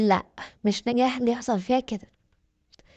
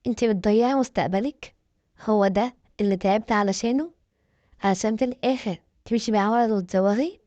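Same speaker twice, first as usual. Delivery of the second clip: ordinary delivery, nothing marked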